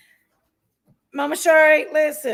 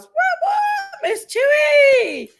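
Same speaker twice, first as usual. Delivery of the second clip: excited squeal; 14 semitones higher, 4 dB louder, much slower than usual. Real, not acted. Excited greeting to a viewer.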